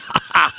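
brief amused laugh. Real, not acted. Host laughs at his own public service announcement. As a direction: break off to laugh.

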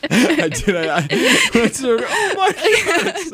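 high, slow laughing words. Real, not acted. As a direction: talk through high laughter. Laughing about muting her.

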